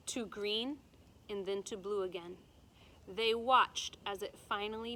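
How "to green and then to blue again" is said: plain reading voice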